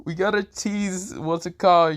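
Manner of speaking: high, slow, drawn-out plea; mock pleading. Drake pleads with Libby to stay, high and stretched. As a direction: plead up high, dragging the words.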